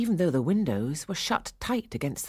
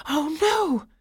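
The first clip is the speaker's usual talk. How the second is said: high, loud cry of dismay; alarmed; 12 semitones higher, 5 dB louder than usual. A narrator's reading. Clara sees Nutcracker cornered by the Mouse King, alarmed. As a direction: let the voice leap up in alarm. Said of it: "Clara thought".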